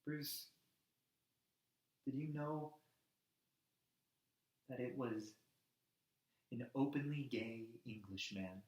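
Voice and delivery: soft, low, halting storytelling; gentle appeal. Acted. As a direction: lead him in quietly, pausing between phrases. Gently addressing Bruce with a story.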